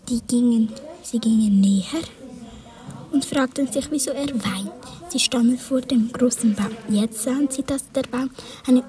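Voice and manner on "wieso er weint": ordinary delivery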